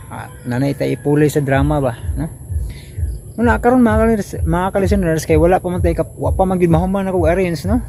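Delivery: ordinary talk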